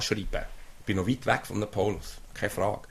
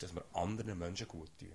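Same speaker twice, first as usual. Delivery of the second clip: much softer, drawn in and intimate; soft restatement; 4 semitones lower, 13 dB quieter than usual. Real, not acted. Preacher softly repeating the goal to the church, hushed. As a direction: drop it low and close.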